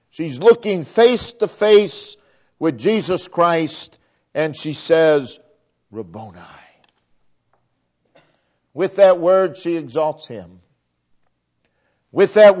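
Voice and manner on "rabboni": much softer and lower, a near-breathless Rabboni; hushed tender. Retold or imitated. Mary, face to face with Jesus, whispers in quiet awe. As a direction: let the word fall quietly in awe. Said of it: "She stands now with her back".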